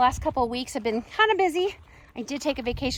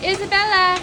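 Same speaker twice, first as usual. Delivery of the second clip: calling out; 6 semitones higher, 8 dB louder than usual. Real, not acted. Calling out to the animal.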